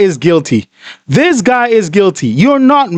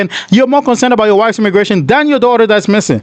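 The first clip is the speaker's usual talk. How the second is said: usual speaking voice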